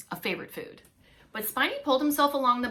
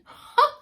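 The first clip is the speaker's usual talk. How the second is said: quick amused high laugh; a burst of real laughter; short laugh; 12 dB louder than usual. Real, not acted. Reader amused by the story, short laugh.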